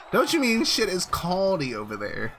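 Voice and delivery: hammed-up London detective talk; mock London accent. Streamer riffing on a London detective, mock London accent. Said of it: "he was a detective in London".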